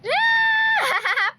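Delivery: high, loud delighted squeal; excited squeal. Real, not acted. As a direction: squeal it with joy. Squealing love at toddler.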